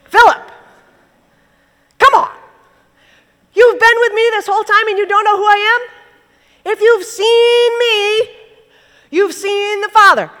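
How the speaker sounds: high, loud exasperated plea; Jesus chiding Philip, exasperated. Retold or imitated.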